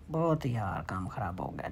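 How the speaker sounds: low, soft letdown; deflated, voice dropping low; disappointed. Real, not acted. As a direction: sink into real disappointment. Lost the round, regrets it.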